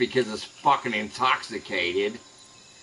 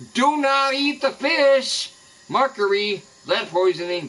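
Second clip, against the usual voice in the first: mock sign reading; 10 semitones higher than usual. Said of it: "signs on a body of water that says".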